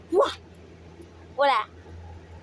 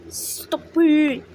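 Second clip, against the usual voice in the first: louder, sharper stop it; firm louder stop it; 6 dB louder than usual. Real, not acted. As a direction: raise the voice on the second try. Repeated scolding, whisper then loud.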